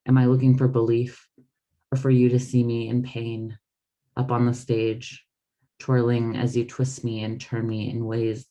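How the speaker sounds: measured, intimate reading of her own letters; literary reading. Acted. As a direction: read it like an open letter to close friends. Author reads her book aloud.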